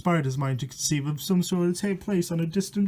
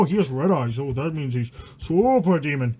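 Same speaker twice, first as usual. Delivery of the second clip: usual speaking voice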